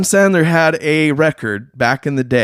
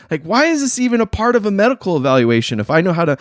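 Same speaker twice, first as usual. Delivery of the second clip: usual speaking voice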